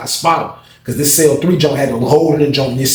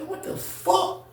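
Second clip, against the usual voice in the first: much higher and much softer, breathless shock; stunned hushed disbelief; 12 dB quieter, much slower than usual. Retold or imitated. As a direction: gasp it out in disbelief. Watching the fight.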